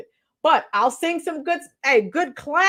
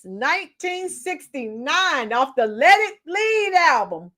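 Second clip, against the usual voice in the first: usual speaking voice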